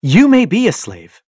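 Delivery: loud, high retort; husband snaps back at his wife, loud; snapping. A narrator's reading. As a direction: bite it off, loud and proud. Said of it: "I snap".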